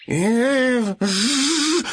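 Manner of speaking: a scolding buzzing zzz; bee buzz. Acted. Bee scolds the greedy piglet, buzzing. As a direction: buzz at him like an annoyed bee. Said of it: "зажужжала пчела".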